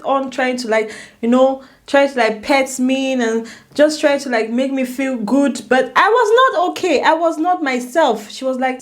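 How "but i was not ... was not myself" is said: high, distressed insistence; upset, emphatic. Real, not acted. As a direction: insist with pitch raised in upset. Reliving her distress at the news.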